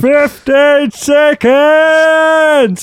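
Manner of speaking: high, slow coach shout; gym instructor impression. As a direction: bellow it like a trainer. Instructor call.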